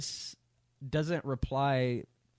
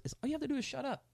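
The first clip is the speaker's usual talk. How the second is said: blunt paraphrase; 9 semitones higher, 3 dB quieter, much faster than usual. Retold or imitated. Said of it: "eh, my words".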